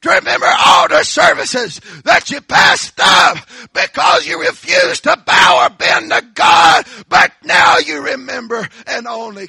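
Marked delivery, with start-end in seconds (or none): 2.0-9.5 roaring, much higher and louder sermon climax; preaching shout. Acted. Preacher roaring at the congregation about refusing God. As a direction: thunder it from the pulpit.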